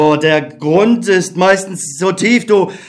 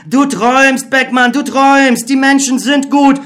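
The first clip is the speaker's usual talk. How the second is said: higher, urgent inner voice; optimistic Other; 6 semitones higher than usual. Acted. The Other urging Beckmann to trust people. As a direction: coax him to believe.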